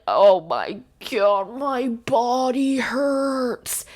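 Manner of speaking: very slow, moaning mock pain; faking tiredness. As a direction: groan it out, hammed-up exhaustion. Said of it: "and he'd be like".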